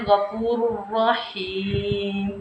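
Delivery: "गफूर रहीम" very slow, doubled r, long "heem"; tanween merged into ra. Shown as a demonstration. Teacher showing the correct reading, drawn-out "गफूर रहीम" with doubled r. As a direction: recite "गफूर रहीम" stretched, with no n. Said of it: "तनवीन की आवाज़ नहीं".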